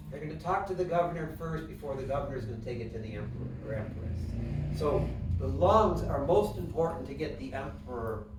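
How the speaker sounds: ordinary talk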